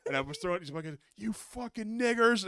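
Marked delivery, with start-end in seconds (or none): 1.2-2.5 louder, slow, playful jeer; mock kid taunt. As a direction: throw it out like a kid. Child throwing ball at pool.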